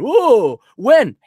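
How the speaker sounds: hammed-up eager questions; mock eager asker. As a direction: play the pushy questioner.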